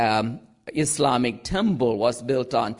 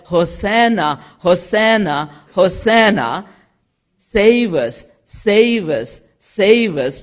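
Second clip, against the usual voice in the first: lightly imitated crowd cries, louder; crowd acclamation; 4 semitones higher, 7 dB louder than usual. Same people who said Hosanna, crowd acclamation. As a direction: punch out each repeated cry.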